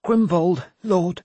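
put-on high, scared young man's voice; timid, high-pitched reply to a lord; frightened stammered answer. A narrator's reading. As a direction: blurt it out in fear, pitched right up. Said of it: "who stammered the answer".

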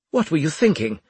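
loud, high, abrupt demand; sudden demand. A narrator's reading. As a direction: break in loudly, demanding an answer. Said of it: "Winslade demanded suddenly".

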